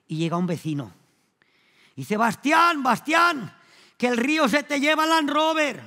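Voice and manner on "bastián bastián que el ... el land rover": loud, higher yell across the field in the rain; calling out. Retold or imitated. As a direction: shout the warning to the shepherd. Neighbour calls shepherd in rain. (gritando desde lejos, agudo y fuerte)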